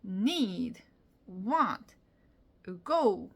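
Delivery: "need", "want", "go" each stressed and falling, after a barely-there onset; long, weighty "need", "want", "go"; reduced subject, strong verb. Shown as a demonstration. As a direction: punch "need", "want", "go" one at a time. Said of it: "이렇게 하는 거죠".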